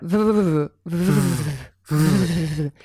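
breathy rhythmic tiger chuff sounds. Shown as a demonstration. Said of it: "do a tiger chuff if you can".

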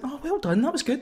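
a lightly mocking pat on the back; mock praise. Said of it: "we usually end some of our features by saying".

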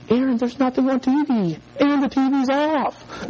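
put-on high, pleading little kid; mock distress. Five-year-old telling his brother the TV is off, mock distress.

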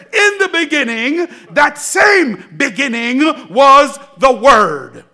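shouting preacher, proclaiming to the church; preaching at full force. Acted. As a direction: hammer the point home.

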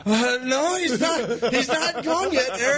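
high, strained retold call; calling back. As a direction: call back to a hiding friend, acted high-pitched. Retold calling back to hidden friend.

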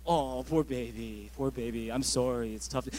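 half-hearted comfort. Retold or imitated. Husband forced to comfort his wife, grudging and low. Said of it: "I'm like".

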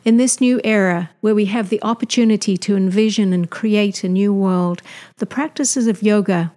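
loud, polished welcome to listeners; scripted host announcement, projected and evenly phrased; podcast intro read. Acted. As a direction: read it out like a show opener.

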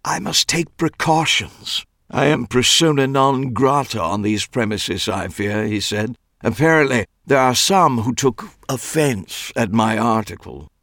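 fussy Clive voice. A narrator's reading.

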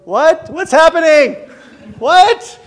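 high, loud baffled cries; confused disciples. Retold or imitated. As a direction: blurt it out, totally lost and shocked. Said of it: "I think they're like".

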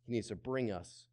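ordinary talk

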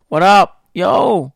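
loud, punchy hype yell; screaming into the mic. Real, not acted. DJ greeting listeners on air, screaming and yelling. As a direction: yell it, pitch jumping up at the end. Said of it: "Screaming and yelling".

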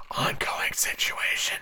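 low whispered aside. Real, not acted. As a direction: drop to a whisper for this bit. Muttered aside about the pandemic.

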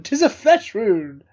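lightly voiced Monty Python knight; Monty Python quote. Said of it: "The, the Monty Python".

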